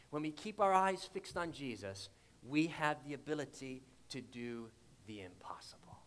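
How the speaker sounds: a sermon's hushed climax; hushed intense close. Acted. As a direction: drop to a whisper to drive it home. Preacher hushes to close point.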